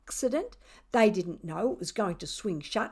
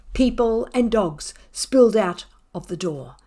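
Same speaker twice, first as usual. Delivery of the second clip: ordinary delivery, nothing marked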